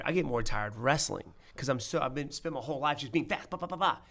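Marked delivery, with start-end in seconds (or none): 3.5-4.0 high-pitched burst of punch noises; imitated rapid strikes. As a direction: rattle off quick punch sounds. Imitating quick strikes while describing being fast.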